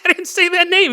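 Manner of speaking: high, loud startled outburst; shocked. Acted. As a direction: jump at it, spooked. Shocked at stranger knowing name.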